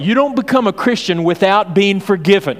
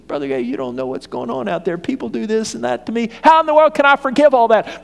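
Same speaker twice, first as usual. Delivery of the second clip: complaining churchgoer; 6 semitones higher than usual. Retold or imitated. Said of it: "congregant's complaint voiced".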